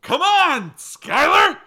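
high, loud call from far off; high-pitched shout to a straggler; yelling across a river. Acted. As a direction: holler it across the water. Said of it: "I yell across the river at Skylar".

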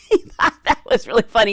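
plain reading voice

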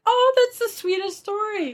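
high-pitched, melting coo of delight; touched gushing. Retold or imitated. Host retelling her reaction to a sweet story, touched gushing. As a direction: gush as if melting over something adorable.

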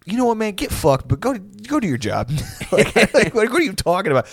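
high-pitched sputtering frustration; hammed-up fed-up telling-off; exasperated boss. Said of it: "when you say something so frustrating to somebody".

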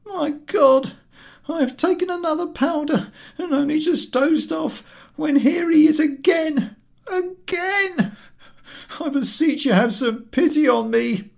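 high, loud, pained wail; woebegone invalid. Acted. Invalid to the ticket collector, woebegone and wailing. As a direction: moan like a sick man woken again. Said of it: "with a woebegone face".

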